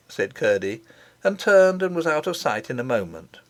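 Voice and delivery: ordinary delivery, nothing marked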